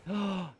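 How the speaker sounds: sharp gasp of disbelief; shocked gasp. Real, not acted. Gamer gasps at his drowned dino, shocked.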